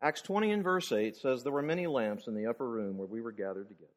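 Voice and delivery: soft, low, unruffled answer; reply quietly and evenly, letting it drop away; calm. Retold or imitated. Radio host answers a caller's challenge from scripture, very calmly. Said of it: "very calmly said".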